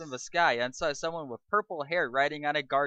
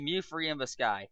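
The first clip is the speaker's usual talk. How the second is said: ordinary talk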